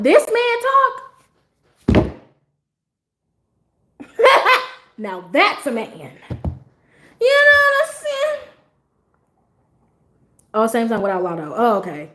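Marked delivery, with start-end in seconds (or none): 0.0-2.1 swooning. Real, not acted. Swooning over a deep voice. 4.0-4.7 high giddy squeal of a laugh. Real, not acted. Swooning over a deep voice. 7.2-8.4 high, loud giddy question; giddy swoon. Real, not acted. Swooning over a deep voice.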